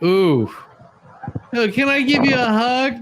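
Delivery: imitating her, pitched well up and whiny; mock pleading hug request. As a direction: mimic her begging for a hug, high and silly. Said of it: "He's like".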